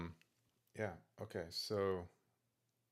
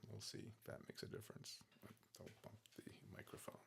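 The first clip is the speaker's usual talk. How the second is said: whisper; 19 dB quieter, faster than usual. Real, not acted. Reminding himself during recording.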